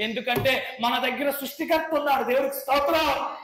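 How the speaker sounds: builds to a high, loud preaching cry; preaching at full force. Real, not acted. Preacher rebuking auspicious timings before the church, rising to full force. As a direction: hammer it home to the congregation.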